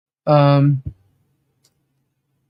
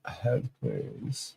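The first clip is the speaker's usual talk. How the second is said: whisper; 9 semitones lower, 17 dB quieter than usual. Real, not acted. Aside to someone off mic.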